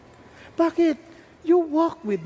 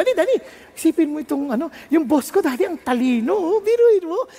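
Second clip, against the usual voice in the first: high, animated rush of news; excited boy; 5 semitones higher than usual. Acted. Boy reporting his boss's coffee scheme, very excited. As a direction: tell it excitedly, pitch jumping up. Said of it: "the boy was so excited".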